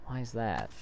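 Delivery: ordinary talk, nothing marked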